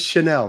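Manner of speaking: "Chanel" as shuh-NELL; corrected pronunciation. Shown as a demonstration. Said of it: "how do I say that?".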